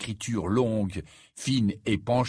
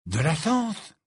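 higher-pitched burst of sudden inspiration; sudden realisation; 8 semitones higher, 3 dB louder, slower than usual. A narrator's reading. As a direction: blurt it out as the idea strikes. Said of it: "répéta soudain Ron". (exclamation soudaine, plus aiguë)

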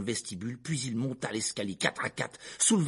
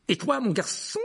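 tense uncle, pitched higher; hissed warning; 10 semitones higher, 3 dB louder than usual. A narrator's reading. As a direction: turn on the boy, strained and threatening. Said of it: "siffla-t-il à l'adresse de Harry". (menace tendue, plus aiguë)

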